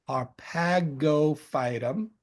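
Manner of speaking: "Harpagophytum" drawn out slowly, part by part; slow enunciation. Shown as a demonstration. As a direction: say "Harpagophytum" at half speed, as if teaching it. Repeats Latin name slowly to teach.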